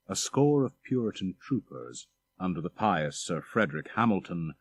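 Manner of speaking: usual speaking voice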